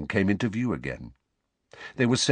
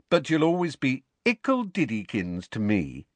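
higher, teasing coo; babying taunt in a raised pitch; mocking baby talk; 6 semitones higher than usual. A narrator's reading. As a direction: needle your cousin with the pet name. Taunting cousin with baby nickname.

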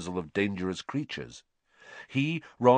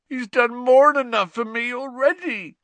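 high, loud anguished groan of a big man; 8 dB louder than usual. A narrator's reading. Giant sobbing to his young friends, groaning. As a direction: groan it out through tears. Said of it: "groaned Hagrid".

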